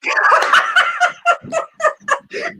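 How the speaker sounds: long high real laugh. Real, not acted. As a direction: crack up at the one-word answer. Laughs at wankers reply.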